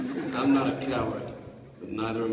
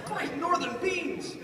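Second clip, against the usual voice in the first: high, slow drawn-out exclamation; squeaky hammed-up amazement; mock astonishment; slower than usual. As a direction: stretch it out in comic disbelief. Capping run of exclamations.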